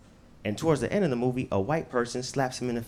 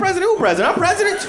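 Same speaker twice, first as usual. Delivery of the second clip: very high, loud, bewildered; confused old Biden; 8 dB louder, faster than usual. Acted. Comic Biden confused about being president. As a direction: squawk it like a lost old man. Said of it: "He like".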